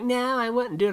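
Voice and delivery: squeaky mock voice of people saying no; mock refusing people. Mocking people who say they would refuse, high mock voice. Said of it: "People are like".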